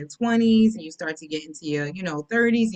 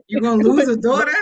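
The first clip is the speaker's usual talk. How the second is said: louder and higher, amazed; disbelieving echo; 4 semitones higher, 8 dB louder than usual. Real, not acted. Co-host repeating the daughter's threat in loud disbelief. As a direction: throw it back in shock, laughing at it. Guest echoes the line in disbelief.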